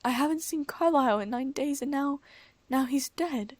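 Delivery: crying out in loss; anguished. A narrator's reading. Davina grieving Carlisle, anguished and loud. Grief over Carlisle's death.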